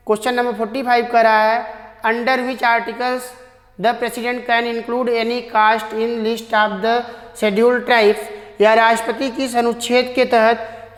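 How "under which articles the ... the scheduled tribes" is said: slow, careful reading of the question; slow dictation reading. Shown as a demonstration. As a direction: read each word slowly so students can follow. Teacher reads question aloud slowly. (धीमे-धीमे प्रश्न पढ़ना)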